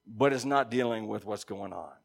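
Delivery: normal talking voice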